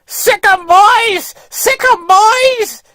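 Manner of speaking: high, loud mock yell egging on a pack of dogs; imitated yelling. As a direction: mimic a woman urging her dogs on, high and loud. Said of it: "some woman up on top of the hill yelling".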